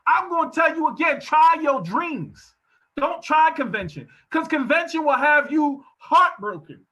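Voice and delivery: loud, high preaching climax. Acted. Preacher urging the crowd to chase their dreams, loud. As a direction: preach it hard to the congregation.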